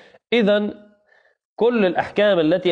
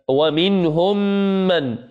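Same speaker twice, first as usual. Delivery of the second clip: normal talking voice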